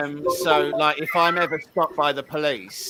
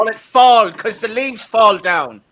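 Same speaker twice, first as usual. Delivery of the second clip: loud, high-pitched exclamation; excited outburst; 9 semitones higher, 11 dB louder than usual. Real, not acted. To friends, loudly exclaiming about American words. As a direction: burst out about the leaves falling. Mocking American word for autumn.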